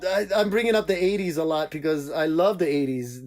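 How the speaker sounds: normal talking voice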